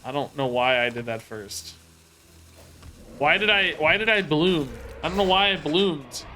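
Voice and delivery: usual speaking voice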